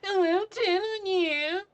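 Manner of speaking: loud, drawn-out squeaky falsetto; exaggerated whiny falsetto taunt; mocking falsetto. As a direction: mimic them sky-high and slow. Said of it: "his voice rising to falsetto to mock you".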